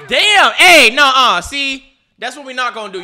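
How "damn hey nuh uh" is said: loud, high cry of protest; indignant outburst. Real, not acted. Viewer protesting a shove on his player, indignant outburst. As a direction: object loudly, pitch jumping up.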